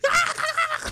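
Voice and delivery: very high-pitched cackling laugh; hyena cackle. Retold or imitated. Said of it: "like fucking hyena laughing".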